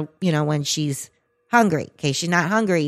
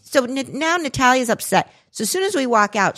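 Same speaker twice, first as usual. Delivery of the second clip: usual speaking voice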